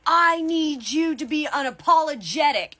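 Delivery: high-pitched, emphatic imitation of a demand. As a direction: drive each word home, pitched well up. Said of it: "she's getting loud with me".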